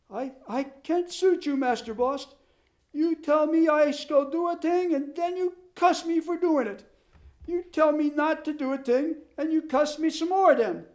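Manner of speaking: high, complaining Swedish farmhand; Swedish accent. Acted. Ole's immigrant dialect voice.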